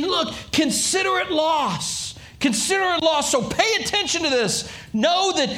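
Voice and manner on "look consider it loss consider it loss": preached warning from Paul; urgent warning. Retold or imitated. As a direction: urge them gravely to count it loss. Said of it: "Paul's saying".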